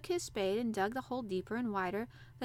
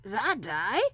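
loud Yorkshire disbelief, swooping; drily exultant old gardener; dry exultation; 7 dB louder than usual. A narrator's reading. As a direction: scoff triumphantly at the idea. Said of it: "he said with dry exultation".

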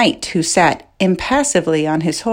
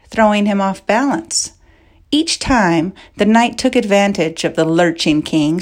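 ordinary delivery

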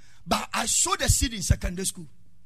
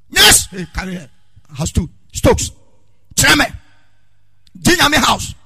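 calling out names; 11 dB louder than usual. Retold or imitated. Senior calling out house names.

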